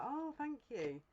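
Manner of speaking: high, drawn-out "oh", then softening thanks; mock-polite reply. Advising listener how to answer a rude admirer, mock-polite. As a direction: put on an over-polite, high thank-you. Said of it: "Just reply and be like".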